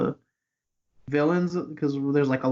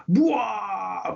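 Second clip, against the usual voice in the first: mock yell; 5 semitones higher than usual. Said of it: "we see a giant".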